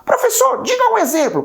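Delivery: high-pitched student calling the teacher; high mock-student voice; student asking. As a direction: pipe up like an eager pupil.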